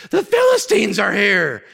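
loud, slow alarm call, voice pushed high; panicked shout. Retold or imitated. Intern runs to the king yelling alarm. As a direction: burst in yelling the enemy has come.

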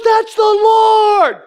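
high, loud, very drawn-out LORD; hammed-up cry, stretching it way out; emphatic exclamation. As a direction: proclaim it like a sudden revelation. Wife's exclamation retold.

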